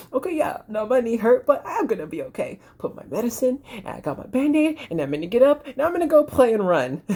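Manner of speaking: put-on high, chirpy child voice; brave happy kid. Kid with Band-Aid deciding to play, high and cheerful. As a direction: kid bouncing back, upbeat. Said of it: "they're gonna be like".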